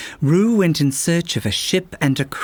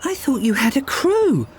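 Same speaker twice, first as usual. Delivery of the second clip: much higher, puzzled question; confused; 10 semitones higher than usual. Acted. Girl finds the captain alone on the ship, confused question. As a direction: ask it bewildered. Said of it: "she asked in confusion".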